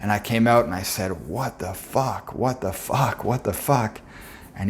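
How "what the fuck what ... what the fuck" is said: slow, understated amazement, drawn out; retold stunned disbelief. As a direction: repeat it slowly, as if mildly dumbstruck. Retelling stunned return from trip.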